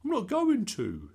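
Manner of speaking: put-on loud, high, exasperated boy; exasperated sigh. A narrator's reading. Bertie fed up with his parents' advice, sighing. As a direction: protest with weary exasperation. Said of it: "sighed Bertie".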